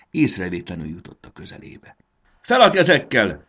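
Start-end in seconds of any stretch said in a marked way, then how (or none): 2.4-3.4 high, loud challenge; commanding shout. A narrator's reading. Marci surprises the caterpillar from behind, shouting a command. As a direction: shout it like a hold-up. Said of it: "kiáltott rá a hernyóra".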